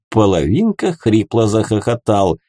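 ordinary delivery, nothing marked

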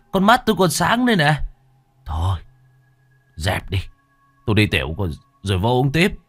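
put-on slurred, stammering drunk protest; drunk insisting. A narrator's reading.

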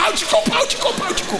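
very high, frantic repeated plea; a panicked big brother begging for silence; high-pitched frantic shushing; panicked hush. Retold or imitated. Brother panicking, hushing him.

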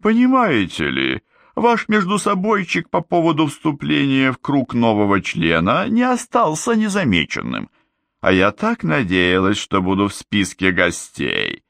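mock offended. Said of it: "Голос звучал обиженно".

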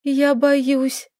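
scared, shaky repeat; trembling lips. A narrator's reading. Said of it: "дрожащими губами повторила она".

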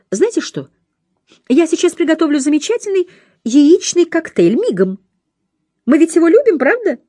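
high sugary voice, doting and overbearing; fussy cooing nurse. A narrator's reading. Companion coaxing the old lady into a drink, high cooing voice. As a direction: coo at her like a fussing nanny, pitched well up.

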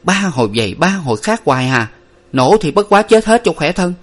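irritated, rising to a high finish; fed-up big sister; annoyed. A narrator's reading. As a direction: snap out of exasperation with your sibling. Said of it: "Nguyệt bực mình".

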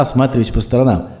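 usual speaking voice

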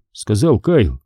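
ordinary delivery, nothing marked

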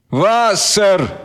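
gurgle the word through a throat of blood; gurgling. A narrator's reading. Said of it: "пробулькал волонтёр".

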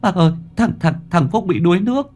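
high, broken stammer; stammering in panic. Acted. Boy telling Phúc's mother, stammering high. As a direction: stumble over the words, terrified. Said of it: "lắp bắp mãi mới thành lời".

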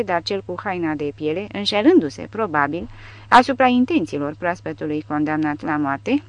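plain reading voice

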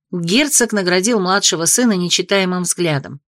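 ordinary delivery

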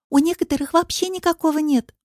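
put-on high-pitched girlish agreement; catty little echo, pitched high; chiming in. A narrator's reading. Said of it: "поддакнула Алочка".

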